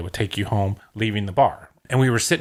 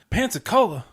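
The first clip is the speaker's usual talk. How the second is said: high, loud mocking drunk sneer; drunk stranger's jeer; 11 semitones higher, 5 dB louder than usual. Retelling the drunk man mocking Pensacola, loud drunk jeer. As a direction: sneer it like a drunk picking a fight. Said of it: "This drunk, he goes".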